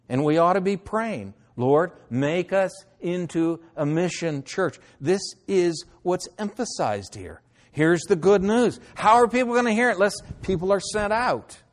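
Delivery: high, loud urgent appeal; fervent preaching. Real, not acted. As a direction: rouse the church to pray, pushing up high. Urgent call to pray, mission.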